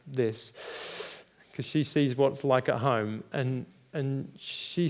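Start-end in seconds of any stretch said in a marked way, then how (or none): none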